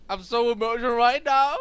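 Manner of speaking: loud, overblown mock gush; mock emotional actor. Mocking the actor at the panel, loud hammed-up gush. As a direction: ham up an actor gushing about his feelings. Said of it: "he was, you know, kind of like".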